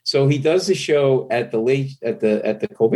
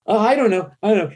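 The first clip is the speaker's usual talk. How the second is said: high, nervous fumbling; flustered stammer; 11 semitones higher, faster than usual. Retold or imitated. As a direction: squirm through it. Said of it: "I go".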